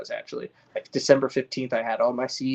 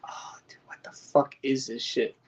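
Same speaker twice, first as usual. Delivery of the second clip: shocked whisper; 3 dB quieter than usual. Retold or imitated. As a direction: breathe it out like you've just spotted the ball sacks. Retold discovering male plants.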